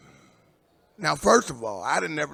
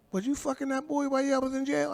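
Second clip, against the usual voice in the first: buddy's question; 11 semitones higher, faster than usual. Retold or imitated. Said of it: "my buddy was like".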